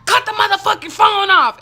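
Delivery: loud, high yell, mimicking an officer; shouted police order. Officer ordering her to end the phone call, shouted. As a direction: yell the command full out, playing the angry cop.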